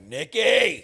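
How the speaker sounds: loud, high shout of a name; mock sleep-talk shout; calling out. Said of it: "I was like".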